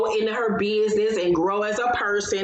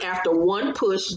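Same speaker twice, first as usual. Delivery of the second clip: usual speaking voice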